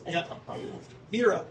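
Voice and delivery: ordinary delivery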